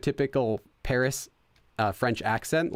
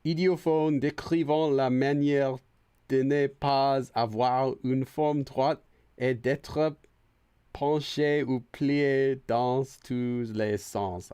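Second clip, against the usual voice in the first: slow, careful reading of French; halting French read; much slower than usual. Real, not acted. Streamer sounding out a French dictionary line slowly. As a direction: sound out each word slowly.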